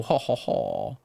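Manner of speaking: put-on low Cajun-accented chuckle; Cajun laugh. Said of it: "the New Orleans accent is more fun to do".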